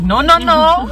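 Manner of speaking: mock scolding. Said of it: "she was like".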